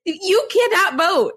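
"cannot vote" slow and high-pitched; emphatic disbelief. Retold or imitated. Host corrects Paloma's vote count, incredulous.

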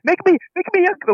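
high squeaky put-on voice; high character voice. Quoting a movie character.

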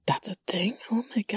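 soft, high, whispery disbelieving question; hushed astonishment. Retold or imitated. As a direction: ask it in hushed, retold amazement. Retells astonishment at the pediatrician's remark.

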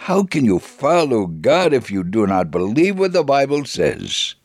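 usual speaking voice

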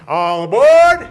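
slow, loud all aboard call; train conductor call. Pretend. Train game, calling as conductor.

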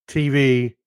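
usual speaking voice

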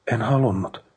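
strained and moved, not truly whispered; tense with emotion. A narrator's reading. Brother explains he didn't want her to suffer, tense with emotion. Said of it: "kuiskasi liikutuksesta kireällä äänellä".

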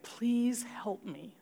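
breathless unvoiced whisper, slow; whispered plea. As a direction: whisper the plea to God, slowly. Desperate prayer in panic.

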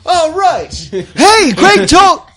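high, loud delighted call; excited greeting. Acted. Andy turns to greet Greg, high and excited.

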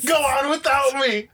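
high, slow, wailing farewell; melodramatic dying hero. Retold or imitated. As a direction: ham up a tragic last goodbye. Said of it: "I was like".

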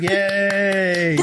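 high, drawn-out imitated yay; hammed-up squeal of joy; mock delighted cheer. Voices Liz cheering reappearing tape.

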